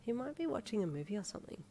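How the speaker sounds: softly reasoning to yourself; quiet inner thought. A narrator's reading. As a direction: muse it under your breath. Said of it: "you thought".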